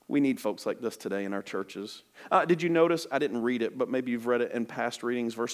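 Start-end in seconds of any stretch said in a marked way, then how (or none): none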